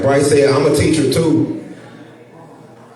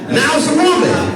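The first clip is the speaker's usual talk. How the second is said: outraged; 12 semitones higher than usual. Acted. Outraged comic punchline.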